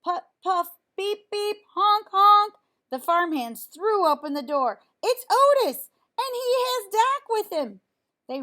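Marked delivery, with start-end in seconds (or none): 0.0-2.5 higher, louder puffing and honking; mock engine puffs and honks; tractor horn noises. A narrator's reading. Tractor horn sounds returning. 5.0-7.8 much higher, louder excited cry; thrilled farmhands announcing arrival; joyful shout. A narrator's reading.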